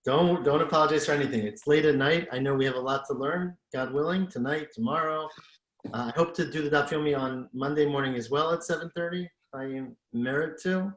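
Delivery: ordinary talk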